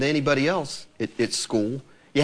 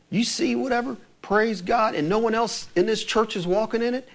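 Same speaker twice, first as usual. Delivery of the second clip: ordinary talk, nothing marked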